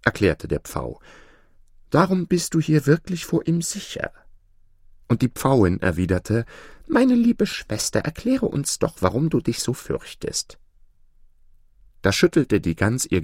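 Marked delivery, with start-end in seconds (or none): 1.9-4.1 lifted, higher storybook voice; reassuring peacock. Acted. Peacock telling the goose she is safe here, reassuring. 6.9-10.6 high opening, easing down and softening; kindly peahen. Acted. Peahen asking the goose why she is afraid, kindly.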